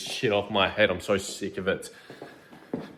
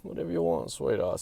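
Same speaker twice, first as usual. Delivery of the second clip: smooth-talker impression; 6 semitones higher, 9 dB quieter than usual. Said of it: "I was like".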